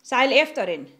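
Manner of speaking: shouted order. A narrator's reading. Captain orders crew to chase dragon, shouting. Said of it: "råbte Rasmus".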